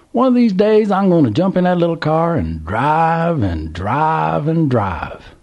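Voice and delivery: put-on high, slow, longing old Southern voice; dreaming old man. A narrator's reading. Old man dreams of driving off in his car. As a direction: dream out loud of escape.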